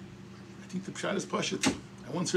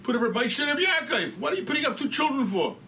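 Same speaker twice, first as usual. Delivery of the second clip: higher-pitched retold protest; incredulous challenge; 7 semitones higher, 4 dB louder than usual. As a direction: argue it as a baffled objection.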